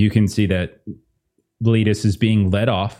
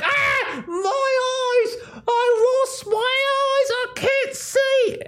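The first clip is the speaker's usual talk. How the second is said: very high, held-back mock screaming; wailing gnome; much slower than usual. Game master plays a gnome pecked by an owl, high mock shriek. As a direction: squeal it in slow, drawn-out cartoon agony. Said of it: "this one gnome who shouted".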